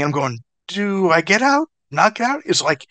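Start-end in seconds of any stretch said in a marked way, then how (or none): 0.7-2.4 slow, high, rising questions; puzzled, dragged-out questioning; retold confusion. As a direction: act out being unsure which order to follow. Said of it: "So now I'm mind fucked".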